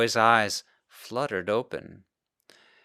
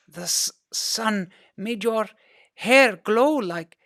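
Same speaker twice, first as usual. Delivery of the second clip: put-on dazed boy; 12 semitones higher, 3 dB louder, slower than usual.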